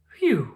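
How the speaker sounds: squirrel's relieved phew; relieved sigh. Acted. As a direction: let the worry out in one breath. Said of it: "Evergreen sighed".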